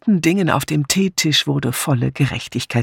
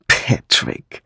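voiceless, breath-filled disbelief; surprised. A narrator's reading. Philippa hearing Patrick linked with her, surprised. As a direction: let the name out on breath, astonished. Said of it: "Jetzt war Philippa wirklich überrascht".

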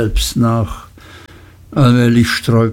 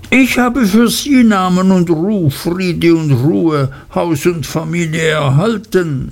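higher, hurried and dismayed; hasty Uriah; 7 semitones higher than usual. A narrator's reading. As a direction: backpedal fast, voice pitched up in alarm. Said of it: "mit einer hastigen, bestürzten Miene, bemüht einzulenken".

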